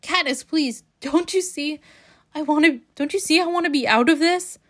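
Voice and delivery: imploring quote from a novel; pleading. A narrator's reading. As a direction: plead as a character, voice lifted. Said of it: "pleading now".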